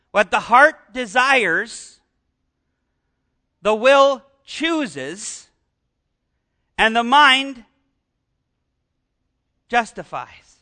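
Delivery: very slow, each phrase weighed with long pauses; slow deliberate reading, leaning on heart, will and mind; slowly, carefully. Shown as a demonstration. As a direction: draw out every phrase so they catch it. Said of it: "Listen to, carefully again".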